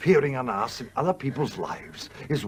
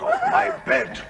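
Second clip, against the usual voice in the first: squeaky, much higher cat voice; 5 dB louder than usual. Acted. Miss Ming the cat complaining, shrill. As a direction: whine like an offended cat.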